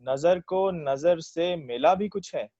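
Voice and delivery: verse lifted above everyday talk; ghazal recitation. Acted. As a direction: present the couplet to a listening mushaira. Recites own ghazal at mushaira.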